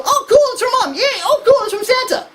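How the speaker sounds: put-on high, excited little kid. Lecturer imitating a delighted child opening presents, high and excited. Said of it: "they go".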